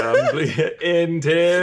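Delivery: lightly voiced AI butler announcer; a hint of Jarvis announcing. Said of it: "It's in Jarvis's voice".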